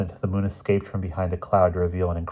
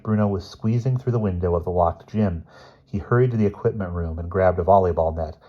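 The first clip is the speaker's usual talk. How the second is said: usual speaking voice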